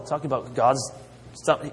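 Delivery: ordinary talk, nothing marked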